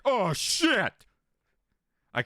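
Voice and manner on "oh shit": mock panic. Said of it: "I'm like".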